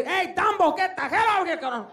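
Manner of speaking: high mock yell as an angry dad; accented dad chewing out his kid. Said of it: "He'll yell at me".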